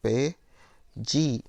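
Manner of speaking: PERDIDA very slow, broken after each syllable; syllable-by-syllable scansion. Shown as a demonstration. Teacher counts poetic syllables, PERDIDA slowly broken apart. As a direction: split PERDIDA into PER, DI, DA with long pauses. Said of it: "o di é a sílaba mais forte".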